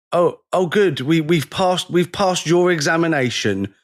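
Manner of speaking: high, mock-grateful sarcasm; sarcastic mock gratitude toward the official. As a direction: thank him with mock relief.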